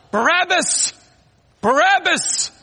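high shouted chant; crowd crying out. Retold or imitated. The mob cries for Barabbas, loud. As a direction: roar the name twice like a mob. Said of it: "He heard them cry".

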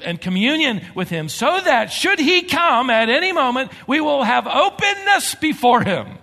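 high, loud, building fervent preaching; preaching at full force. Acted. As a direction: preach it out to the congregation, rising.

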